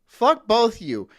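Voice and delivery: loud hammed-up fury; mock angry outburst. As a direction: tell them both off. Telling off imagined coworkers.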